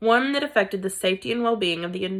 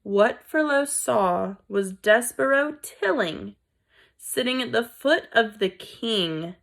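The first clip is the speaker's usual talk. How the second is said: usual speaking voice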